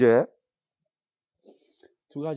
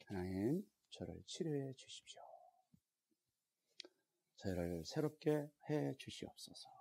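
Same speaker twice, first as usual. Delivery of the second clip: quiet prayer; 11 dB quieter than usual. Shown as a demonstration. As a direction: let the prayer sink to a murmur. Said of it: "가슴에다 이렇게 손을 대시고".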